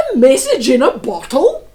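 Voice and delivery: loud, amused pirate call; captain calling to his crew, loud and amused. Acted. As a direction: shout it across the deck, laughing. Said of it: "called the captain to his crew".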